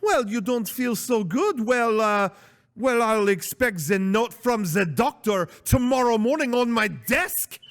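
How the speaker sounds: high-pitched mock French manager; hammed-up French accent, demanding and suspicious. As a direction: put on a high French accent, calling a bluff. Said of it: "a French accent".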